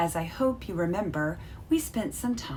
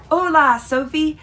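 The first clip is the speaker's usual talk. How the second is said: high, loud, bright hello; big cheerful Hola to a friend; excited greeting; 9 semitones higher, 9 dB louder than usual. Acted. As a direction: call out the greeting with joy. Excited greeting opening the letter.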